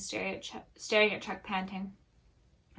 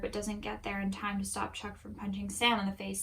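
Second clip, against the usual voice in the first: plain reading voice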